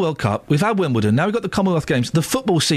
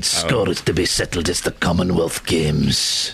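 low, drawn-out promo announcer; mock trailer voice; 8 semitones lower, slower than usual. Host parodying the Commonwealth Games trail, mock trailer voice. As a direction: intone it slowly like a dramatic sports trailer. Mimics the trailer just played.

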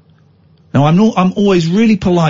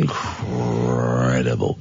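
incredulous; 13 semitones lower, 9 dB quieter, much slower than usual. Real, not acted. Disbelief at the rudeness.

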